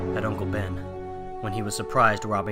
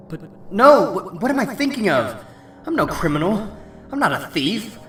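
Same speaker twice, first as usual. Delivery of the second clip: higher-pitched, agitated self-rebuke; shocked at himself; 7 semitones higher, 4 dB louder than usual. Acted. Hero rejecting the thought of stealing, appalled at himself. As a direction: burst out loud on the no, then protest.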